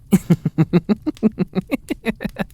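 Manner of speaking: a high, amused giggle. Acted. Captor giggling to the captive about the spiked drink, high giggle. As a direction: giggle at your own trick.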